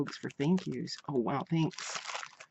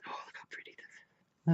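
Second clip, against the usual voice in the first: whisper; 4 dB quieter than usual. Real, not acted. Admiring a card.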